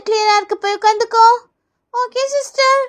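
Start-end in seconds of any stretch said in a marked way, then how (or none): 1.9-2.8 high schoolkid reply; child pupil. Acted. Pupil telling nurse it doesn't hurt, child voice. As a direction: answer in a kid's voice.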